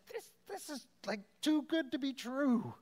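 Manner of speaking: high, awestruck voice of overwhelmed disciples; disbelieving joy. Retold or imitated. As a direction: sound like it's too good to be true. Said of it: "It's just like".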